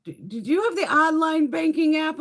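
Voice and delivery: high, loud, chirpy mock of a bank teller. Bank teller pushing the app on her, mocking high chirpy voice. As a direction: imitate the pushy teller, hammed-up high.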